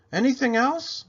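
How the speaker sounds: high, loud eager maid; Amelia Bedelia voice. Acted. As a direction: ask it bright and eager.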